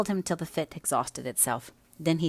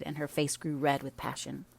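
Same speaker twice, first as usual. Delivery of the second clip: ordinary delivery, nothing marked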